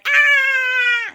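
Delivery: very high, loud, long shriek; shrieking ride scream. Acted. Boy screams on the roller coaster, high shriek. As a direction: scream as on a big drop.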